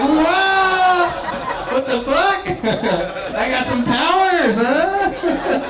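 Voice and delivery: high, loud astonished yell; laughing mock amazement; amazed shout. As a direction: squeal it in disbelief, laughing. Acting his own amazed reaction.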